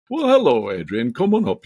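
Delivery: high, loud Cumbrian greeting with a bright lift on hello; grandfather's Cumbrian accent. Retold or imitated. Grandson imitates his Cumbrian grandfather calling him up, in a Cumbrian accent. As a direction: call up the stairs in a lightly done Cumbrian grandpa voice. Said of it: "He talked like that".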